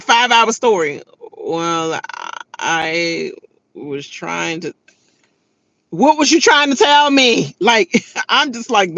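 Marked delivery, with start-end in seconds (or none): 1.4-4.7 halting, soft and drawn out; slow-talking mother. Retold or imitated. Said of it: "when people just talk that slow". 5.9-7.6 exasperated, raised-voice interruption; impatient retort. Retold or imitated. Impatient retort to slow talker.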